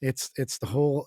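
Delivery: ordinary talk, nothing marked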